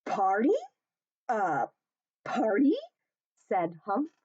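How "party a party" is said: rising, louder eager "party?"; eager surprise. Acted. Hippo wakes up hearing about a party, eager. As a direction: perk up at the word party.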